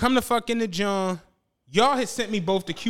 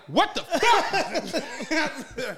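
stunned disbelief; 6 semitones higher, much slower than usual. Retold or imitated. Retold reply to the desk woman.

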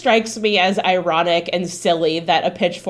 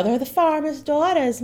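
put-on a weak stab at a rural Southern accent, pitched up; bad farm accent; 6 semitones higher than usual. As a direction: attempt a country accent and miss. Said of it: "that was not a good accent".

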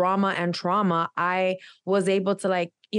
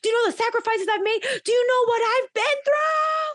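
much higher and fast, retold hurt indignation; mother's retold defensive rant; indignant outburst; 14 semitones higher, faster than usual. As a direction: fire back high and quick like you've been wronged.

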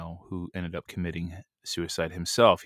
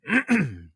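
throat clearing; 12 semitones higher, 6 dB louder than usual. Real, not acted. Clears throat mid-sermon.

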